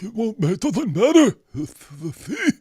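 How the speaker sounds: whiny mock of the friend's excuse. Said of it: "Yeah, you'd be like".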